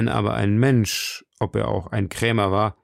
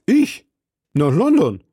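high, louder startled questions; astonished; 6 semitones higher, 5 dB louder than usual. A narrator's reading. Husband told to go to London, astonished. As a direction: balk in disbelief at the idea.

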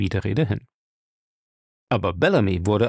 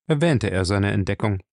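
ordinary talk

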